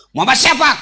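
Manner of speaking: loud, questioning call. Acted. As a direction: shout back through the door. Voice behind door answering.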